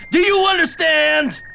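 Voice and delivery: sharp high yell of a question; exasperated demand. Acted. Chief demands agent understand, loud and high. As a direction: bark it at the agent.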